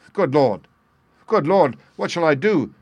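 high, loud cry of despair. A narrator's reading. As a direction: cry out in panic. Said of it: "cried the stranger in an ecstasy of despair".

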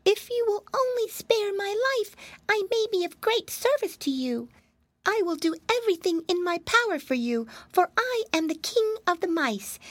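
very high, squeaky begging mouse; pleading mouse. Acted. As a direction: squeak and beg for your life. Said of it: "the pleading tones of the little creature".

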